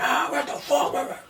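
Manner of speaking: high, drawn-out scream without much volume; mock scream. Big opponent screams after hitting the mat, high mock scream. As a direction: imitate a frustrated scream, high and slow. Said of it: "he starts fucking screaming".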